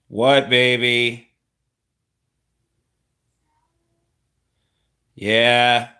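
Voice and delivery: loud high exclamation. Real, not acted. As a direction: blurt it out loudly. Suddenly loud after calm.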